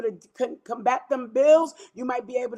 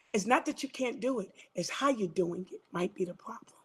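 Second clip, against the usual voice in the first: whisper; 4 semitones lower, 8 dB quieter than usual. Real, not acted. Whispering the point to the listeners. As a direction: drop to a hushed whisper.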